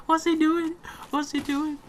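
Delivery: confused by the other player; baffled squeak. Real, not acted. Gamer baffled by an enemy, voice shooting up high.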